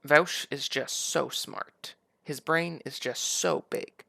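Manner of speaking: slow, mock-admiring drawl; sarcastic praise. Host mocking Vaush's intelligence, slow and sarcastic. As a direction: lay the sarcasm on thick, slowly.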